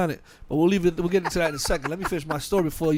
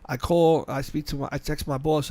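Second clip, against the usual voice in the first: ordinary talk, nothing marked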